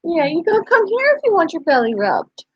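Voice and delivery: pet talk. Real, not acted. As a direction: tease the dog into coming closer. Talking to the dog about belly rubs.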